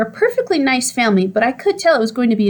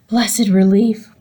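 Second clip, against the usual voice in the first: slow, drawn-out sigh of relief; relieved; much slower than usual. A narrator's reading. Hamster relieved the TV is finally off, slow and savouring. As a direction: savour each word, slowly.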